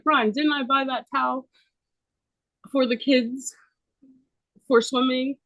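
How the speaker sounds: a hint of a bossy mom demanding backup; imitating mom. Mom voiced asking stepdad.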